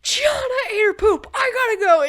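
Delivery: high, loud frantic yell; retold frantic cry about eaten poop; panicked shout. Panicked call to mom on speaker.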